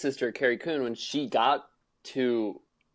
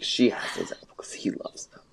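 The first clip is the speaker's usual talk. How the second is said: breathy whisper, near tears; whispered Benji quote. Retold or imitated. Friend whispers Benji's line, close to tears. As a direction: whisper it, voice catching. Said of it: "Are you crying?".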